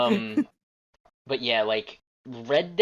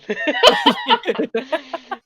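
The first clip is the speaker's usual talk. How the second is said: long high-pitched laugh; 8 dB louder than usual. Real, not acted. Cracking up after the slip.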